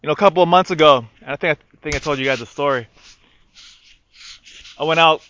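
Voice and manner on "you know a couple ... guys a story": usual speaking voice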